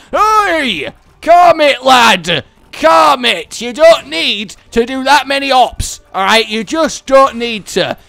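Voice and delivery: loud, high telling-off; scolding shout. Real, not acted. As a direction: yell at them to calm down. Scolding game enemy.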